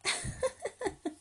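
high, rapid little giggle. Acted. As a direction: ticklish cub laughter. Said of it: "entre risas".

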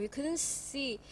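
usual speaking voice

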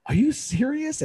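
acted-out indignant astonishment; retold disbelief. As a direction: react as if you can't believe it. Father reacts to coach's snub.

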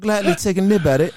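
put-on British or Australian accent. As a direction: play at sounding British or Australian. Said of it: "that's not bad".

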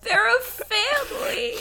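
high-pitched, hammed-up choked-up voice; mock tearful. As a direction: play at being moved to tears. Overcome retelling emotional hospital scene.

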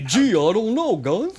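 Alf voice. Retold or imitated. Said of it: "Was that your Alf impression?".

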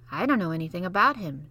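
ordinary delivery, nothing marked

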